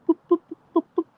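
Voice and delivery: string of very high squeaky boops; rapid playful squeaks, tapping the snoot. Real, not acted. Booping the dog's snoot.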